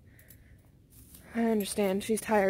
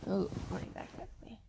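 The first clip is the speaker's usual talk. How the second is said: pained murmur; 10 dB quieter than usual. Real, not acted. Back pain after bath.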